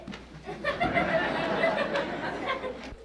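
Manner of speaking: high delighted giggle. Acted. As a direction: giggle high like a pleased schemer. Delighted scheming laugh.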